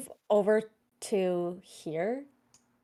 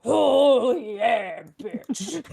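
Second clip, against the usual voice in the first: Macho Man voice; 5 dB louder, much slower than usual. Acted. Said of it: "Now it's Macho Man Randy Savage, dire wolf".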